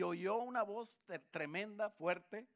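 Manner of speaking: fuerte y agudo, predicando a todo pulmón; voz de trueno. Acted. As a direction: anuncia la voz como un trueno. Predica la voz que oyó Saulo. (predicador clamando)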